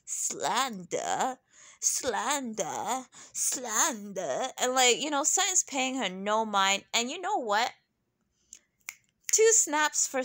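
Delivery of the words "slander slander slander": slow, drawn-out mock accusation; Erica Jane. Mimicking a castmate jumping into the argument, drawn-out mock accusation. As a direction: stretch each repeat out theatrically. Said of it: "Erica Jane has to jump in with".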